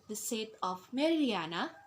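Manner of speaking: "Meridiana" dips down then swings up; fall-rise. Shown as a demonstration. Teacher showing fall and rise, "Meridiana" dipping then rising. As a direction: let "Meridiana" fall and climb back within the word. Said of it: "fall and rise".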